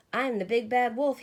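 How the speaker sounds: frustrated wolf boasts, howling. A narrator's reading. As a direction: howl out the boast. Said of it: "he howled".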